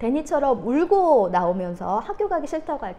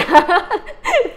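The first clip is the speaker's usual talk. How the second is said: put-on high, loud huffy grunt of a sulking child; mock huff; 9 semitones higher, 12 dB louder than usual. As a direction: huff like a cross little girl. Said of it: "이러면서 딱 앉는데".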